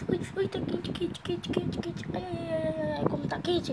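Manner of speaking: ouch it's hot. Pretend. Grabbing hot pan by hand.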